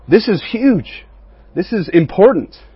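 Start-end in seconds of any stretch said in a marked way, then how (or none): 0.1-1.1 slow and high on "this is huge", each word leaned on; emphatic. Real, not acted. Youth pastor urging the group to see the point, slow emphasis. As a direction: drive home "this is huge". Preacher stressing a key point.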